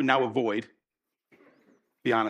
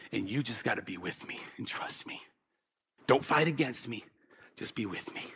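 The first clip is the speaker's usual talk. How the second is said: tender hush; 6 semitones lower, 10 dB quieter than usual. Acted. Jesus tenderly reassures the believer.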